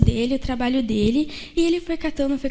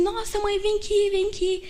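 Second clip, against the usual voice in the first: excited call; 6 semitones higher than usual. Retold or imitated. Boy excitedly calls his mother.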